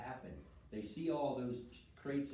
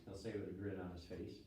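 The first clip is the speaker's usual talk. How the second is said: usual speaking voice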